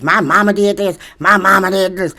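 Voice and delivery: mocking vulgar commenters. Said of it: "very vulgar ladies cursing".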